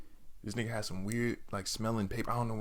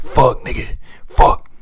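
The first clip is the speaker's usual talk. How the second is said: panicked inner curse; 11 dB louder, much slower than usual. Retold or imitated. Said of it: "I'm just like".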